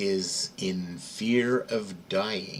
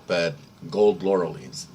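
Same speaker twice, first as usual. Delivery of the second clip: usual speaking voice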